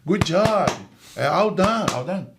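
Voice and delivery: high, breezy empty good job; false praise. Retold or imitated. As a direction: give hollow encouragement. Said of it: "não quer um falso apoio".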